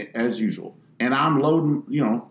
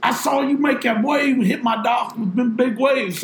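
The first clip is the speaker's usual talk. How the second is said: 1 angry homeowner; 12 semitones higher than usual. Retold or imitated. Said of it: "he says".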